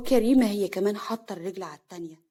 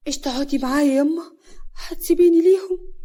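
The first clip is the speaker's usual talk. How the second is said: higher, louder plea rising at the end; pleading; 5 semitones higher, 5 dB louder than usual. Acted. Daughter begging her mother to stay, pleading. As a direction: plead not to be left behind.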